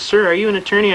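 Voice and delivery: incredulous judge questioning from the bench, mildly acted; retold judge's question. Acts out the judge questioning him.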